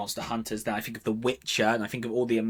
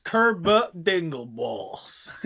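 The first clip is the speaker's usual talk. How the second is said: slow, drawn-out hammed-up American accent; exaggerated, badly faked American accent; terrible American accent; 4 semitones higher, much slower than usual. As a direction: stretch it into a silly American drawl, half laughing. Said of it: "our terrible accents".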